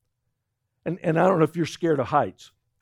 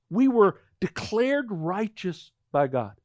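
usual speaking voice

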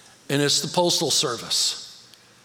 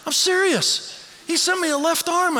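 excited; 14 semitones higher than usual. Real, not acted. Telling the church about the arm gift, excited. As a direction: burst out with the punchline of the gift.

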